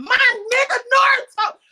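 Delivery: loud, high hype scream at the screen; excited yelling. Retold or imitated. Cheering at the TV as Naruto arrives, loud yell. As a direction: yell it like your hero just showed up. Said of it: "that's literally how I was yelling".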